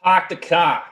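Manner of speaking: loud Boston "Park the car" on request; Boston accent. Pretend. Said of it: "can you say".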